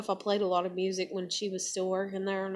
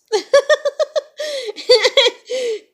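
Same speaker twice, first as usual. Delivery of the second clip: high laugh; 11 dB louder than usual. Real, not acted. Laughs at the memory.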